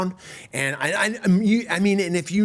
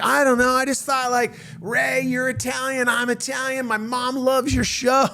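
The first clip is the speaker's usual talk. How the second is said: higher-pitched, mildly pleading confession; sheepish pleading; 8 semitones higher than usual. Retold or imitated. Comic explains his movie hopes to Ray, mildly pleading. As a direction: retell an awkward appeal, voice lifted high.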